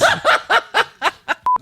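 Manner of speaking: high laugh. Real, not acted. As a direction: burst out laughing. Laughing at the flubbed outro.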